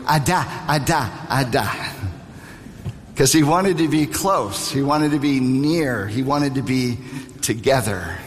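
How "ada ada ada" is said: lightly voiced toddler calling Ada; a hint of a small child reaching for dad; toddler calling daddy. Father recalling his little son toddling over, lightly voiced. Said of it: "he would toddle over ... and go".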